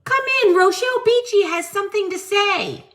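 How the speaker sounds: lightly hammed-up preacher greeting; mock Rochelle Beachy. Host imitates Rochelle greeting viewers, mocking sweetness. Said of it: "I almost fell off my chair".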